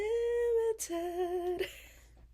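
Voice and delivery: squealing high laugh; high cracked giggle at her own answer. Real, not acted. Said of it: "Always cracked a little".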